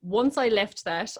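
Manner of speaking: ordinary delivery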